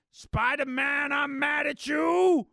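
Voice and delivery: slow, loud furious shout; angry shout. Acted. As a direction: yell it slowly, seething. Daredevil confronts Spider-Man.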